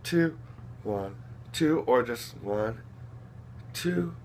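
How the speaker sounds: hushed one two; soft rocking count. Shown as a demonstration. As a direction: count gently like rocking a baby. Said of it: "I add a little double time".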